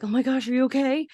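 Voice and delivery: high, quick mock-anxious question; worried. Parents to Max after the smoke, worried.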